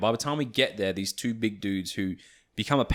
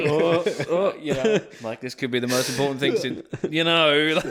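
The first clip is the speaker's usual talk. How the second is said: higher, louder hammed-up excitement about a silly match; mock hype; 5 semitones higher, 4 dB louder than usual. Mocking travelers hyping a hostel drinking game, in a hammed-up excited voice. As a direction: play up fake gravity for comic effect. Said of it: "They were like".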